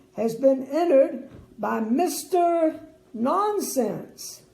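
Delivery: slow, grand royal announcement; king's announcement. A narrator's reading. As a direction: draw out the name for the crowd. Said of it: "the king went on".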